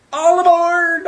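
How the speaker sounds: high, loud rallying call; calling out cheerfully. Acted. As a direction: call it like a ship's captain. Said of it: "Oscar called".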